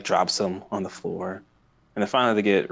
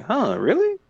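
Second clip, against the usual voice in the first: higher, a doubtful huh; surprised Sokka; 12 semitones higher than usual. Retold or imitated. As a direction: ask it in raised disbelief. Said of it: "And Sokka's like".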